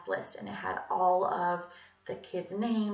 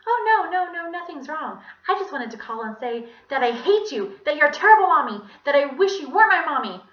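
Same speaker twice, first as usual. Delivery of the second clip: put-on high child voice, growing louder and harsher in feeling; Ronnie; 8 semitones higher, 12 dB louder than usual. A narrator's reading. Villain posing as a child on the phone to mommy, childish. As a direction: start innocent, build to hateful. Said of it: "he said in Ronnie's voice".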